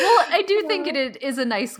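light laugh spilling into words; laugh, then keep talking. Real, not acted. Cohost still laughing while agreeing, bright. Both laughing over credit.

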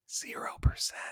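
hushed dramatic whisper. Acted. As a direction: breathe it out like grim news. Dramatic reveal of bad stat.

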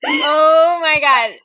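slow, loud, laughing oh my God; real laughing outcry; laughing shock. Real, not acted. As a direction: crack up in disbelief. Shocked by the lyric.

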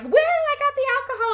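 high, whooping party entrance; excited announcement. Retold or imitated. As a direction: sing out the good news to the room. Acting out a triumphant party entrance.

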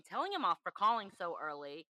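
usual speaking voice

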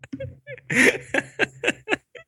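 high-pitched, stuttering giggle; giggling laugh. Real, not acted. Guest joking about drinking all day, giggling. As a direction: crack up at your own joke.

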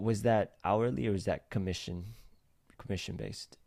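ordinary talk

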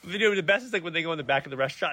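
ordinary talk

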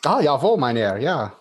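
put-on German accent. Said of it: "can you do a German accent?".

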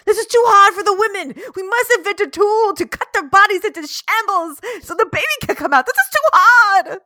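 high, loud, hammed-up alarm; shrieky mock panic; panicking men. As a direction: wail frantically as overwhelmed doctors. Acting out panicking male doctors.

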